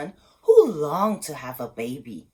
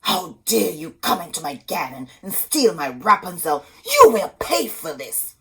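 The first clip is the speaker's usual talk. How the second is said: louder threatening witch voice; angry witch; 7 dB louder than usual. Acted. Sorceress scolding the husband in her garden, angry. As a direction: threaten the thief furiously. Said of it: "she said".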